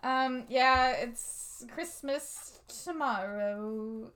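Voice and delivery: very slow, drawn-out delivery; halting, subdued comic quote; quoted show bit. Acted. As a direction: drag out each word, quietly.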